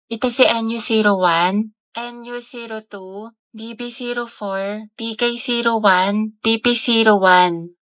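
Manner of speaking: slow, spaced shade codes; slow product listing. Acted. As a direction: read each code out slowly. (mabagal na pagbasa ng mga shade)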